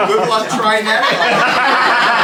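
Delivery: hammed-up slow teasing; slow, drawn-out tease. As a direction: stretch out the mocking dare. Comic exaggerated finish.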